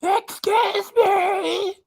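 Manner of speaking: very high, drawn-out mocking screech; imagined yell. Streamer imagining Chantel yelling at people, mock screeching yell. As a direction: screech it slowly, rising at the end. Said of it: "She probably yells at them".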